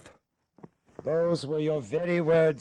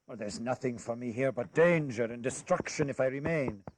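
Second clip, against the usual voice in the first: ordinary talk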